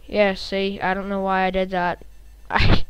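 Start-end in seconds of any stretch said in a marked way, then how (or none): none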